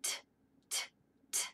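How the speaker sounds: short unvoiced T clicks; crisp T taps. Shown as a demonstration. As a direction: tap out "T" like a tooth. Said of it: "tap his tooth like this".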